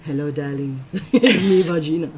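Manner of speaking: lightly voiced campy diva greeting; a hint of a theatrical diva; campy drag queen. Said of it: "talk like her".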